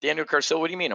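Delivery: incredulous, pressing for an answer; freaking out. Retold or imitated. Wife questioning husband about the name, freaking out. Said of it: "she's freaking out".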